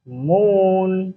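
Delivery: usual speaking voice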